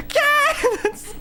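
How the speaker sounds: very high shriek breaking into laughter; imitated scream. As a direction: squeal it in mock terror while cracking up. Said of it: "I just hear screams".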